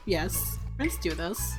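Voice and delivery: ordinary talk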